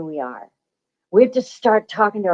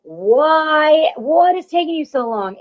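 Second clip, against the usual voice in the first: higher, exasperated mother calling down; impatient mom; 6 semitones higher, slower than usual. Retold or imitated. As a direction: nag like an impatient mom from above. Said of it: "Mom is literally up there going".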